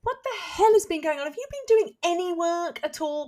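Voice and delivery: high-pitched imitation of an indignant colleague; mock outraged demand. Ash walks in on the laughing during covid, mock outraged. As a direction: demand it in exasperated mock disbelief.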